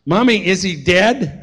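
put-on high, loud, slow child asking; a small girl's frightened question; little girl at the accident asks her mom. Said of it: "she goes".

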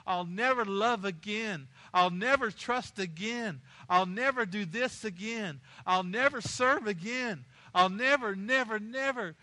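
preacher's hammed-up wallowing; self-pitying moaning. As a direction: moan it out in slow, repeated swells. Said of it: "And we moan".

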